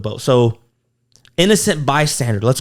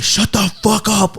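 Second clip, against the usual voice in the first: pitched way up, slow, retold fury; retold angry snap; 7 semitones higher, slower than usual. John grabbing Bob's neck, retold high angry yell. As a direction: bite it out like you're choking him, half-acted. John choking Bob on the boat.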